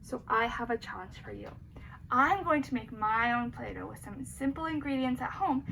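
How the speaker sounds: ordinary delivery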